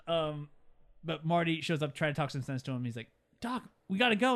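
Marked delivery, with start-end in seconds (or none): 3.4-4.3 raised, high retold urging; urgent call. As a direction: call out loudly to hurry a friend. Marty urges Doc to leave.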